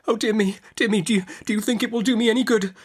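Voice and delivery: high, fast helpless fluster; helpless cry. Acted. Mother to Betsy, helpless and flustered. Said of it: "cried my mother in a helpless manner".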